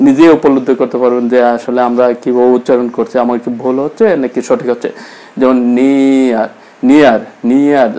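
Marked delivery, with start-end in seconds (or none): 5.4-7.9 নিয়ার said slowly, stretched, with a falling end; drawn-out demo word. Shown as a demonstration. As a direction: spell out নিয়ার slowly like a teacher. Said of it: "আমরা যখন বলি নিয়ার". (নিয়ার ধীরে টেনে উচ্চারণ)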